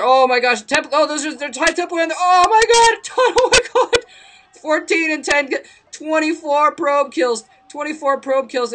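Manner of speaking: high shrieking, laughing disbelief; shrieking jackpot winner. Real, not acted. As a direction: scream it like you won big. Caster screams over huge probe kills.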